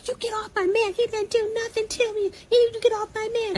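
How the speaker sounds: shrill defence of her man; angry girlfriend. Retold or imitated. Girlfriend defends drunk boyfriend to officer, high mocking yell.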